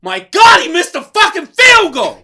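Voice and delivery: high, loud exasperated outburst; outraged fan shouting; mock dismayed fan. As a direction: wail like a fan betrayed by the kicker. Mocking Ohio fan's dismay.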